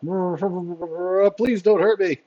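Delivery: high, drawn-out frightened plea; pleading henchman. Acted. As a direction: beg for mercy as a scared captive, slowly. Captured henchman begs the players.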